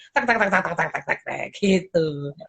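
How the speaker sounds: low, fading repeated tak tak helicopter noise; helicopter sound. Retold or imitated. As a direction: mimic a helicopter coming in. (tiruan suara helikopter tak tak tak yang makin pelan)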